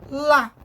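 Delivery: lá alone, loud and punched; stressed syllable. Shown as a demonstration. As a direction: isolate lá with force. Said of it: "Sílaba tônica".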